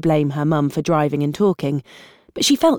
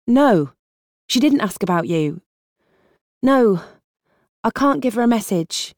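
raised, insistent daughter on the phone; urgent young woman; 4 semitones higher than usual. Acted. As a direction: press hard, no time to argue. Ellie talks to dad on phone.

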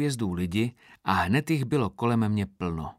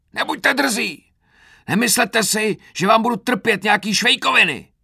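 high, loud tirade at full force; furious roar; 12 semitones higher, 9 dB louder than usual. A narrator's reading. Sergeant refusing to tolerate the recruit's clowning, furious roar. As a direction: bawl out the insolent recruit. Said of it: "řval Krpata".

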